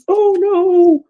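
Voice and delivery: high, loud "oh no"; dismayed cry. Real, not acted. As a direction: cry out as something drops. Drops a piece of craft.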